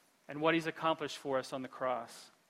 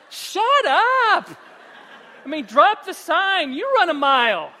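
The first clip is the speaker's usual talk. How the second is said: much higher and louder, laughing through it; mock angry snap; 11 semitones higher, 14 dB louder, slower than usual. Exhausted runner snapping at sign-holders, hammed-up anger. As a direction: laugh while snapping back. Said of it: "And I'm like".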